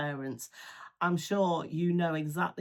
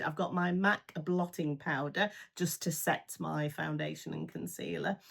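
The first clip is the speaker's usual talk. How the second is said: ordinary talk, nothing marked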